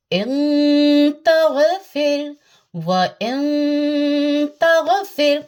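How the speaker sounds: इन तगफिर chanted slowly, nasal held three seconds; three-second nasal hold. Shown as a demonstration. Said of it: "three second hold".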